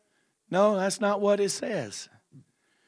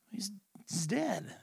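hushed, slow whisper; whispered grief; 4 semitones higher, 15 dB quieter, slower than usual. Acted. Sister whispers to Jesus that Lazarus is dead. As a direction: breathe the bad news.

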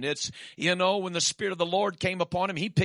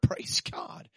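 hushed little aside of praise; whispered praise. Real, not acted. Preacher murmurs praise to himself, whispered. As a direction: breathe a quick praise God.

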